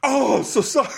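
high-pitched mock sorry; high apology. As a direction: squeak out a quick apology. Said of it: "and I'm like".

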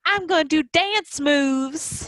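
high, slow mock Southern drawl; hammed-up kid's Southern twang, drawn out. Mom imitating her son playing, in a Southern drawl. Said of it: "doing, like, a southern drawl".

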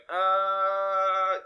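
long drawn-out, held uncertain "uh"; reluctant hesitation, re-enacted; retold hesitation. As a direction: stall on a held sound. Retold hesitant answer to request.

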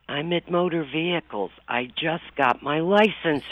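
ordinary talk, nothing marked

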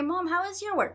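put-on kid asking Mom. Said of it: "And I hear".

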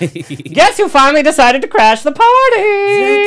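slow, loud, high showy announcer; grand entrance announcement. Acted. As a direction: announce the star guest with flair. Host announcing guest entrance.

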